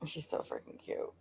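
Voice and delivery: unvoiced whisper, very soft. Real, not acted. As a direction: whisper it fondly to yourself. Gushing aside about friend.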